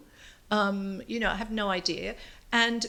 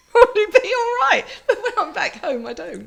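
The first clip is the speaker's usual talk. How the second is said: louder, laughing while talking; 12 semitones higher, 9 dB louder than usual. Real, not acted. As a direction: chuckle through the words. Laughing about her accent.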